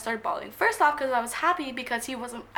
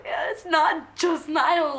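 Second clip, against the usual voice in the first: drawn-out, mildly whiny objection; mock protest; 3 semitones higher, 4 dB louder, much slower than usual. Fan objecting to being told it's just [name], drawn-out protest. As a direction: protest it, stretching the words out slowly. Acted protest to production guy.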